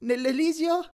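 timid suggestion in a high voice; high, loud and hesitant, stretching the words; Grover. A narrator's reading. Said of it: "suggerì lui".

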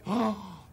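quick frightened gasp. Shown as a demonstration. Fast round of faces, scared gasp.

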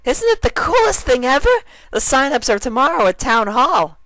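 extremely high, loud, bubbly pony; bursting with glee as Derpy; excited Derpy. Acted.

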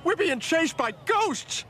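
high, slow, drawn-out alarm; frightened cry. Acted. Passenger to driver chased by ghosts, frightened. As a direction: stretch the words out in fright.

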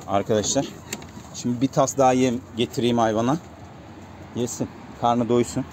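ordinary delivery

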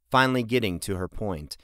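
ordinary delivery

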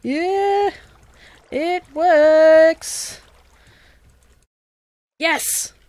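high, loud whoops of triumph; excited shouting. Real, not acted. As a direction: shout it with real, overjoyed excitement. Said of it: "Sorry, I'm shouting".

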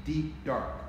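plain reading voice

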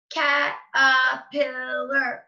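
CATERPILLAR slow, CAT-ER-PIL-LAR; four separate beats. Shown as a demonstration. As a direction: punch each syllable of Caterpillar slowly. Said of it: "Four syllables".

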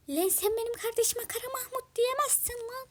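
high-pitched angry protest; indignant sibling outburst. Acted. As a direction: rise high and scold in defence of a sibling. Sibling angrily defends Kara Mahmut.

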